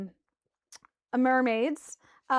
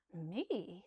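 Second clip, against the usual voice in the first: quiet aside; 4 semitones lower, 9 dB quieter, much slower than usual. Acted. Captain quiet aside to player.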